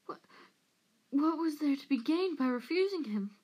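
high, desperate whine; pleading Peter. A narrator's reading. Peter pleads in his defence.

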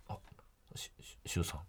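stammered hesitant whisper; wary whisper. Retold or imitated. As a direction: whisper it warily, stumbling. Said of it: "こんな感じかなっていう感じ". (警戒してささやく)

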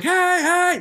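high calling out. Retold or imitated. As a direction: call to friends far away. Calling from cop car.

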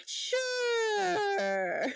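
SURE stretched out long on a high pitch; high, lingering SURE, held and sliding down; long-drawn sure. Acted. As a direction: draw out SURE in a high character voice. In character, grudging agreement, then repeated.

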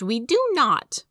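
put-on high, loud, drawn-out kids' protest; indignant protest. A narrator's reading. Little siblings protesting to their brother, indignant. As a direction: draw it out, loudly indignant. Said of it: "both began to protest".

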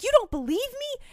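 high-pitched acted indignation; indignant outburst. Woman grabs the detective who walks away, hammed-up indignant challenge. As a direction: shoot it up high, outraged at being doubted.

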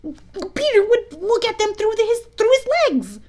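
put-on high, loud boys' exclamation; excited cry. A narrator's reading. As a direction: blurt out the answer loudly. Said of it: "in the same breath they added".

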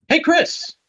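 high-pitched call to a friend. Real, not acted. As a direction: call out brightly for his attention. Host calls on co-host to start.

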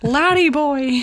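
slow, laughing imitation of a broad Scottish accent; traditional Scottish accent. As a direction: call out like a stock cartoon Scot. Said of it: "He's, like, really traditional".

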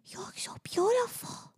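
high breathy whisper; whispered in awe. Retold or imitated. Mother imitating her daughter's awed whisper. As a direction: whisper it like a child in wonder. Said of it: "She went".

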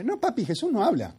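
put-on be the stubborn four-year-old; little girl contradicting. Hija contradice al padre. (voz aguda de niña que insiste)

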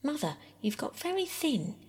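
high-pitched sudden remark; abrupt. A narrator's reading. Bobby to Mother about her thinness, abruptly. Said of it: "said Bobby abruptly".

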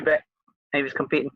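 ordinary delivery

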